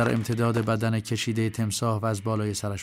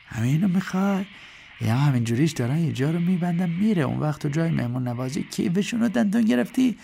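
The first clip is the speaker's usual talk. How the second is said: higher-pitched manager reproaching the crocodile; scolding manager; 5 semitones higher than usual. A narrator's reading. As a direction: chide the animal like a wayward child.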